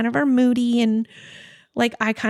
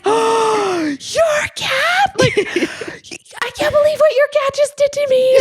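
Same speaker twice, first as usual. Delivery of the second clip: very high, loud whiny complaint; shrill-free high outraged tattletale, loud; tattling; 12 semitones higher, 3 dB louder, slower than usual. Retold or imitated. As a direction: tattle like a wronged child. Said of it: "telling on the cat to her".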